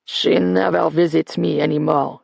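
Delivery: a lightly voiced old man's grumble; old man complaining about his absent granddaughter; grumbling. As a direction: mutter it like a sulking old man. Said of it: "Verdier grumbled".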